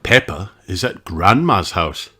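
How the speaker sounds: ordinary delivery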